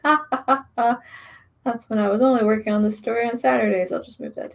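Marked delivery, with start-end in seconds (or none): 0.0-1.0 short amused laugh. Real, not acted. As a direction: laugh at yourself. Laughs at own mistake.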